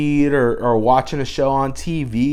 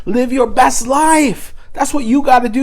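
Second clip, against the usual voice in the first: mock slogan; 10 semitones higher, 3 dB louder than usual. Mocking self-help slogan.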